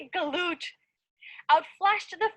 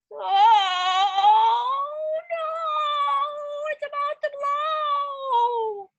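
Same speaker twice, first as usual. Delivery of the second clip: higher, drawn-out alarmed cry; mock alarm; 10 semitones higher, 4 dB louder, much slower than usual. The fellow is about to burst, mock alarm. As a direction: build the suspense slowly.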